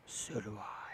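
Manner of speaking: soft unvoiced whisper, low; whispered aside. Real, not acted. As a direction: breathe it out as a secret aside. Whispered aside after loving message.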